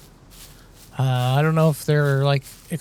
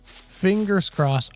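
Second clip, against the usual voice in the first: slow and higher, stretching out "Fingers crossed"; drawn-out fingers crossed; 5 semitones higher, much slower than usual. Shown as a demonstration. Gamer hoping the quad is still there, slow higher "Fingers crossed". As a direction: draw out "Fingers crossed" slowly on a higher pitch. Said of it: "Cr-, fingers crossed".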